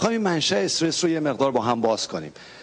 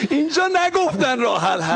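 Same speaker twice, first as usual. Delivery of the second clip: high, laughing through the words; real laughter breaking into the line; 8 semitones higher than usual. Real, not acted. Laughing while joking about in-laws. (با خنده، صدای زیر)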